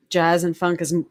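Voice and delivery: ordinary delivery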